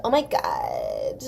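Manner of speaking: put-on vocal fry valley girl drawl. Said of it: "You know, the".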